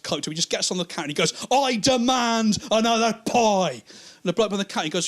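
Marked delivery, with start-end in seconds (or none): 1.5-3.8 high, loud, drawn-out demand; drunken demand. Acted. Drunk customer at chip shop counter, imperious demand. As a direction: bellow each word slowly and imperiously.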